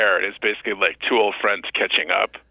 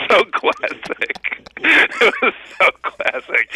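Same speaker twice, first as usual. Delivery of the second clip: laughing; 14 semitones higher, much slower than usual. Real, not acted. Laughing after the story.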